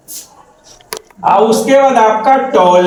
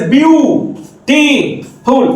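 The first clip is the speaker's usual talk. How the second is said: slow beautiful with long gaps: view, टी, फुल; syllable by syllable; 3 semitones higher, much slower than usual. Shown as a demonstration. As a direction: count out beautiful one syllable at a time. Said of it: "syllable जब बढ़ जाए". (Beautiful रुक-रुककर, ब्यू-टी-फुल)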